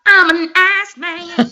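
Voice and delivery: high, loud, slow mock catchphrase; playful wrestler imitation; imitating a wrestler catchphrase. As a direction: bust out the catchphrase, hammed up. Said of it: "everything in me just wanted to bust out".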